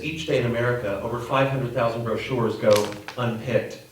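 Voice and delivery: usual speaking voice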